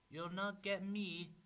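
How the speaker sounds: high, loud defiant piglet; piggy crying out. A narrator's reading. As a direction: cry it out high as a cornered pig. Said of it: "the piggy cried".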